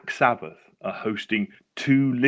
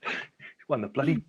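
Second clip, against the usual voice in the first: mock outrage; 6 semitones higher than usual. Real, not acted. Teasing friend about lottery win.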